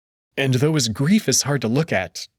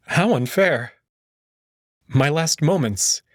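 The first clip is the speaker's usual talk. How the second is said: ordinary delivery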